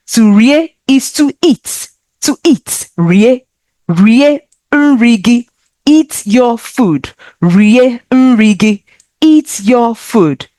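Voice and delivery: usual speaking voice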